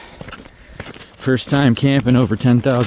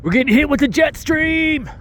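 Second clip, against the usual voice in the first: startled shout; slower than usual. Real, not acted. Wind blast hits them.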